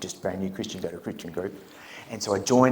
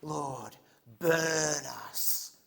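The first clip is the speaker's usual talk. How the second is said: higher, loud drawn-out prayer cry; fervent prayer cry; 6 semitones higher, 12 dB louder, much slower than usual. Retold or imitated. Albie praying to the group, fervent higher prayer cry. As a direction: pray it like a zealous newcomer. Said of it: "And he goes".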